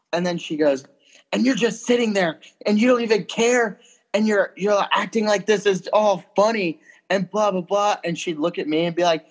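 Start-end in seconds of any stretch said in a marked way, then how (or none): 1.3-8.0 mock angry girlfriend. Girlfriend voiced yelling in story.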